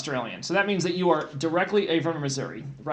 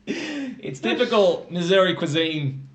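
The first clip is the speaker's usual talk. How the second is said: put-on slow, laughing Australian-style "Missouri" accent; amused Aussie-Missouri drawl; mock Missouri accent; slower than usual. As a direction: joke in a fake Aussie twang through laughter. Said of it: "this is a Missouri accent".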